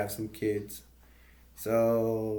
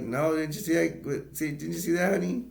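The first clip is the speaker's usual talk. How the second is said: higher acted-out questioning; retold eager question; 6 semitones higher than usual. Clark checks with Audrey after the blast, higher retold voice. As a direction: lightly imitate a dad checking with his kid.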